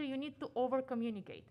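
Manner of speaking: ordinary talk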